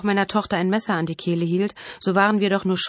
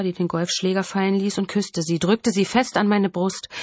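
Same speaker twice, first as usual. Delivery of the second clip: ordinary delivery, nothing marked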